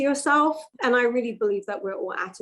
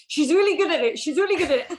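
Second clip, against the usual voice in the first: higher-pitched imitation of boasting parents; lightly voiced parents, pitched up; proud parents; 7 semitones higher, 3 dB louder than usual. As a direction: mimic parents showing off their child. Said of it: "my parents would be like".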